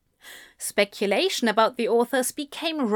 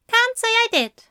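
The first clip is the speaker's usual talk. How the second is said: prim higher voice; 14 semitones higher, 4 dB louder than usual. A narrator's reading. Aziraphale voice.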